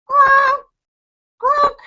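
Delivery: high, shouted croaks; toad croak. Acted. Grandpa doing toad calls for Bobby, high and shouted. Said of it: "cups his hands around his mouth and goes".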